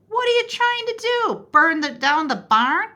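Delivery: high, loud, fast honking goose voice. Acted. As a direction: squawk it like an outraged goose. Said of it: "a goose honked".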